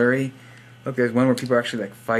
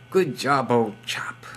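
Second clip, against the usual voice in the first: whispered mock British accent; slower than usual. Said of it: "Why am I speaking in crazy accents?".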